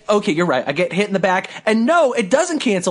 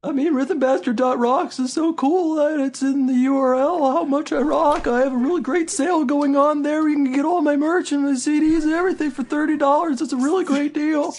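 higher goofy turtle voice; cartoon turtle voice; 5 semitones higher than usual. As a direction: sell merch as a cartoon turtle. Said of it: "cartoon turtle voice".

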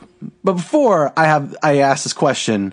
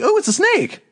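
high-pitched mock casual remark; not upset. Imitating the badger chant's singers spotting a snake, unbothered. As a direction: voice it high, without alarm. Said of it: "they're not, like, upset about the snake".

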